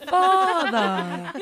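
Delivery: put-on loud, high, drawn-out accented "Father"; mock accent. Host correcting the misheard pet name, hammed-up accent. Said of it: "Are you saying farther".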